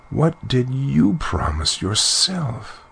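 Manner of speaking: high, slow, plaintive question; drawn-out sorrowful reproach in a lifted voice; sad reply. A narrator's reading. Princess turns the question on her mother, sadly. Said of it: "replied Bellissima sadly".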